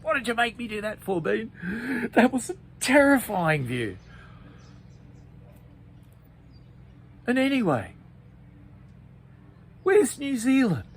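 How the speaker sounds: high, loud scared whine; terrified complaint. Acted. Pete scolds Bean after the scary view, high and loud. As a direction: grumble in fright at your friend.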